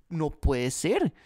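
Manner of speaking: slow, drawn-out disbelief. Retold or imitated. Narrator quoting what anyone would say: slow mock disbelief. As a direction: stretch it out in shock.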